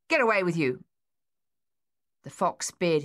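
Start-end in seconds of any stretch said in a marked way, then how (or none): 0.1-0.8 shooing away. A narrator's reading. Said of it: "trying to scare it off".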